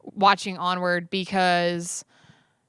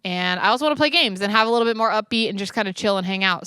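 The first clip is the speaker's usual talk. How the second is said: ordinary talk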